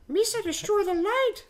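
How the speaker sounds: very high, put-on alien goofball voice; high cartoonish sidekick voice; Jar Jar. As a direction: do a squeaky falsetto creature impression. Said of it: "Did you say Jar Jar?".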